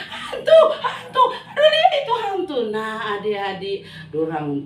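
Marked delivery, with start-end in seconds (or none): 0.1-2.6 very high, loud scream with nervous laughter; terrified shriek. Acted. Disciples seeing a figure on the water, shrieking in fear. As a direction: shriek at the ghost in terror. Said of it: "Makanya berkata".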